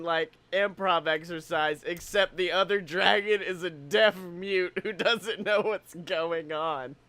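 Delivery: ordinary delivery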